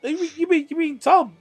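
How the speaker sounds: high, stammering acted surprise; can't believe the name just heard; startled recognition. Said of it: "She's like".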